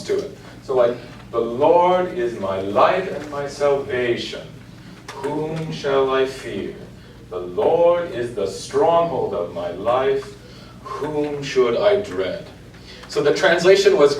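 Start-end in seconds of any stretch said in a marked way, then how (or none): 1.3-12.5 slow, bouncing reading, words grouped on the beats; bouncy sprung rhythm. Shown as a demonstration. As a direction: lean into the bounce of the groupings, slowly. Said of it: "the text of the rhythm kind of has a bounce".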